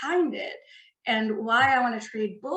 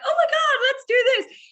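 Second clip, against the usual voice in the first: high, loud burst of over-excitement; too excited; 14 semitones higher, 7 dB louder than usual. Retold or imitated. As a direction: act out getting carried away, pitched way up and loud. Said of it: "sometimes I get too excited".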